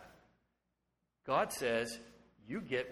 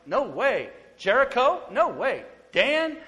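rising higher and louder with each name; God amazed; 9 semitones higher, 7 dB louder, slower than usual. Retold or imitated. God marveling at each listener by name, building excitement. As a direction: build astonished delight name by name. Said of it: "he looks at you and goes".

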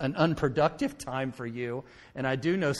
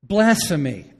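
loud, half-laughing blasphemy; word with a laugh; 5 semitones higher, 11 dB louder, slower than usual. Real, not acted. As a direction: blurt Blasphemy out with a laugh, louder. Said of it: "let me give you a word for it".

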